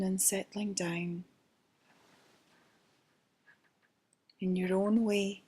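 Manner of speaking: guided meditation. Acted. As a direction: ease them into calm, gently. Leads a guided relaxation.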